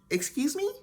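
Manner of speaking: high rising mock disbelief; shocked excuse me. Friend reacts to the mom's refusal with high shocked disbelief. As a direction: let the pitch jump up in outrage. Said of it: "my friend was like".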